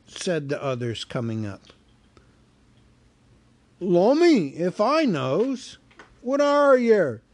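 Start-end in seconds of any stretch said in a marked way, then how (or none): 3.8-7.2 high, loud, slow troll voice; troll asks the hobbit what he is; puzzled troll. Acted. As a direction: scratch your head as a dim troll. Troll puzzles over Bilbo.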